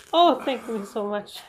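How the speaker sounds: ordinary talk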